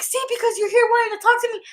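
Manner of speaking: high, loud, rushed imitation of an annoyed mom; mock maternal blame. Imitating mom who just dropped something, snappy mock blame.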